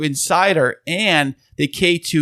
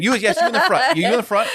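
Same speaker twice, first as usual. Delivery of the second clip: mock host calling on someone; 7 semitones higher, faster than usual. Mock calling on an audience member.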